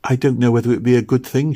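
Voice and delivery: put-on hurried, doubtful little girl; a hint of a worried child; girl's voice. A narrator's reading. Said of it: "she said".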